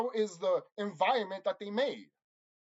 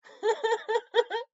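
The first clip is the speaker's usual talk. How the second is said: burst of laughter; 5 dB louder than usual. Real, not acted. Laughs at own point.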